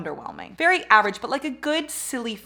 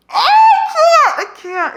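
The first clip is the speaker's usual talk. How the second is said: high, slow cringing wail; 14 dB louder, slower than usual. Retold or imitated. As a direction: whine it like you can't bear it. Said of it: "I'm like".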